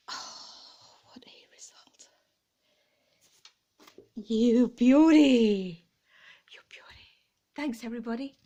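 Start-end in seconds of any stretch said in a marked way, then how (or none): 0.1-2.1 breathless whisper of relief; hushed, amazed at the result; awed whisper. Real, not acted. Owner whispering in relief after a tricky job. 4.3-5.8 loud, slow drawn-out cry of triumph; triumphant cheer. Real, not acted. Owner cheering loudly after the lights survive. 6.5-7.1 a hushed echo of triumph; whispered cheer. Real, not acted. Owner whispering her joy right after the cheer.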